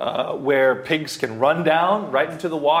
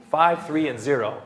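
ordinary talk, nothing marked

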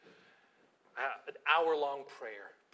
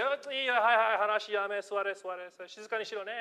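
calling the class to order; 6 semitones higher, 6 dB louder than usual. Retold or imitated. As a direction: settle the rowdy students down firmly. Calming rowdy seventh graders.

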